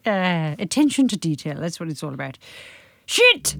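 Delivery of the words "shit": high sharp curse; scream. Acted. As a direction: yell it from afar. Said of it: "screams Derek".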